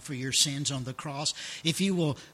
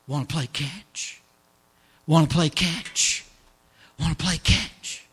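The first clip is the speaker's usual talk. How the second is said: father's call; 6 dB louder than usual. Acted. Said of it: "the father who comes ... and says".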